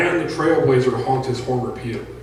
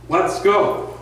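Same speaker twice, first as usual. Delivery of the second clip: higher, louder call to the group; yelling; 7 semitones higher, 5 dB louder than usual. A narrator's reading. Mick calling the riders to swerve into the left lane, loud yell. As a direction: shout it out to lead the riders. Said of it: "Mick yelled".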